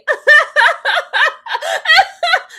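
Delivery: shrieking laugh; loud high laugh. Real, not acted. Host cracking up at the couple, loud high laugh.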